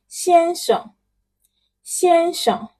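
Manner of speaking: "先生" said high and level; model word. Shown as a demonstration. Teacher drilling titles with students, high and clear. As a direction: hold "先生" high and even. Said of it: "Please pronounce it".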